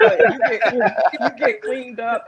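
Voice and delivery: high squeal breaking into laughter; laughing trainer. Acted. Wife as corner trainer, laughing. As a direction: play the corner coach while cracking up.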